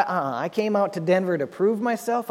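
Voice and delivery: normal talking voice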